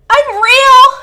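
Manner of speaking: high scream at full volume; anguished scream. A narrator's reading. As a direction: scream it at the sky. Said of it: "Tyler screams".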